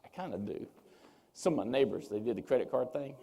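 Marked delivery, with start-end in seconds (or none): 0.0-0.7 low, soft confession; hushed, low aside; subdued admission. Real, not acted. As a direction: own up quietly, dropping the pitch. Same admission repeated, subdued.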